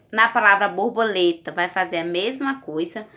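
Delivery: ordinary delivery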